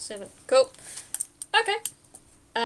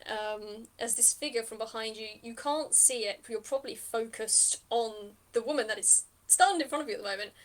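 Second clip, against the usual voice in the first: usual speaking voice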